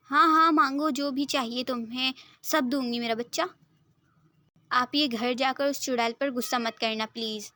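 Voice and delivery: ordinary delivery, nothing marked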